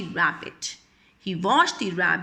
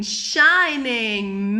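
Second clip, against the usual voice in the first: usual speaking voice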